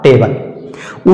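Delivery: drawn-out "टेबल", te-bal; correct pronunciation. Shown as a demonstration. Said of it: "इसका सही उच्चारण हो जाएगा". ("टेबल" धीमे और धीरे, टे-बल)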